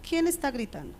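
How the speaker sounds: soft, unruffled question; calm. Acted. Mother responding to reacting children, calm quiet question. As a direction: ask it quietly, refusing to match their yelling. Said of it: "me quedo calmada y le digo".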